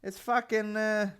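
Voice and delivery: high bloke voice; breezy wave-in, mocking it; mimicking staff guy. Recalling staff guy's words, high mocking voice. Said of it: "staff guy quoted again".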